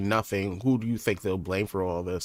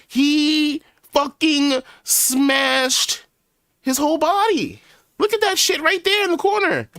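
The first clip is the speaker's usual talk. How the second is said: real high-pitched scream of shock; 6 dB louder, slower than usual. Real, not acted. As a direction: scream it out, shocked and near tears. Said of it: "I'm not, I'm not screaming this".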